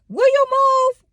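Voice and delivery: shrill, high and loud; fussing at the woman in the parking lot. Retold or imitated. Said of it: "why I was fussing".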